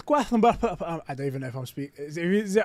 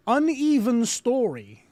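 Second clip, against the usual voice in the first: incredulous; 6 semitones higher, slower than usual. Real, not acted. Reading a review in disbelief.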